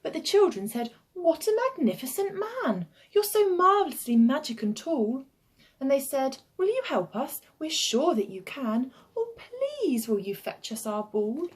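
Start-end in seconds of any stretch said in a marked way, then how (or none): 1.1-5.3 put-on high, gushing children's voices; admiring children. 6.6-11.5 put-on high child voices begging a favour; pleading children.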